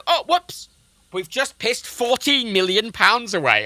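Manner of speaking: breezy mock "oops" with a high start, then slow; mock careless council. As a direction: play the council shrugging off a blunder. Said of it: "and went".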